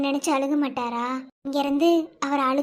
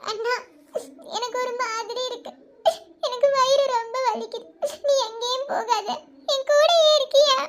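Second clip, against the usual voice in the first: high, crying and pleading; frightened weeping plea; tearful begging; 6 semitones higher than usual. Acted. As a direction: beg him through sobs not to leave. Sick sister begs brother to stay.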